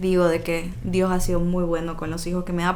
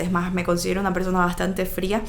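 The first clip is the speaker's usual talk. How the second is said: usual speaking voice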